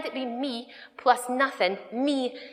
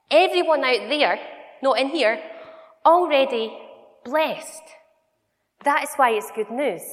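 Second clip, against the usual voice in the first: proclaiming; 4 semitones higher, 6 dB louder than usual. Real, not acted. Preacher proclaiming to audience.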